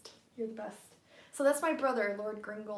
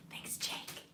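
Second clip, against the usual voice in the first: whisper; 10 dB quieter than usual. Real, not acted. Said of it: "I'm trying to be really quiet".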